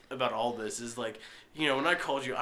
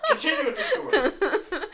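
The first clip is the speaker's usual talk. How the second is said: laughing; 6 dB louder than usual. Real, not acted. Still laughing after the scare.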